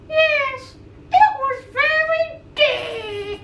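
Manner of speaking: high, slow puppet voice. Pretend. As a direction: play a cheerful little puppet.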